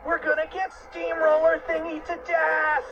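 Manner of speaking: high-pitched cartoon terror; screamed panic. A narrator's reading. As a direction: cry it high in mock despair. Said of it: "screamed Fluffy".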